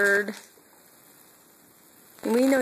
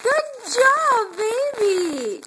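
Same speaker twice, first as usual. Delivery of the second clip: excited cheering; 11 semitones higher, much slower than usual. Real, not acted. Mother cheering her baby crawling, high and excited. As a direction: cheer him on with joy.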